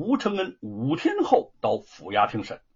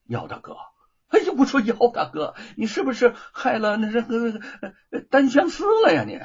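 laughing heartily; 7 semitones higher, 4 dB louder than usual. Acted. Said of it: "吴承恩哈哈大笑".

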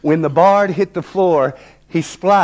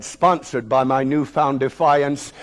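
ordinary delivery, nothing marked

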